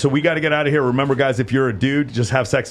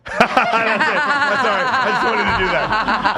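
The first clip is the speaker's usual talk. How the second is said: laughing; 9 semitones higher, slower than usual. Real, not acted. Laughing through own joke.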